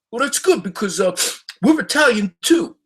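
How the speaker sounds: high, brash Philly Italian tough guy calling out; hammed-up street guy hailing a celebrity at a bar; South Philly dude. Philly dudes at a bar calling to Don Vito, high brash mock voice. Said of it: "They said".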